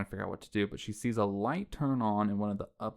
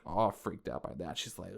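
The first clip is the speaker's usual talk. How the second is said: usual speaking voice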